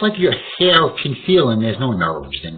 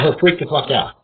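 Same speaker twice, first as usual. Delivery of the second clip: ordinary talk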